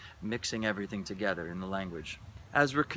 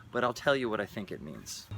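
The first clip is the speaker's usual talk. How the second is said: ordinary talk, nothing marked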